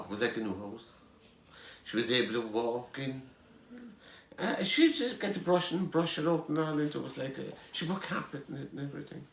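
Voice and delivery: ordinary delivery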